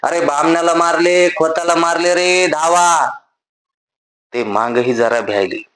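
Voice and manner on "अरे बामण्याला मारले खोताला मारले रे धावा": put-on old woman's alarm cry. A narrator's reading. Old woman shouts for villagers to come running. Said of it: "एका म्हातारीने ओरड केली".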